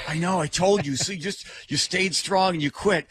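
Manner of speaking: excited reassurance. Retold or imitated. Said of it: "I said".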